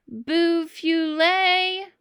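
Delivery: high, loud, slow call through the woods; dad calling son home from afar; calling from far off. A narrator's reading. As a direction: call his name across the woods. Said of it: "a voice called through the woods".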